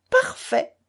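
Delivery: high, loud gleeful shout; pitiless cry. A narrator's reading. Grouchenka cries out mercilessly as the Poles lock themselves in. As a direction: crow it out without mercy. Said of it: "cria Grouchenka impitoyable".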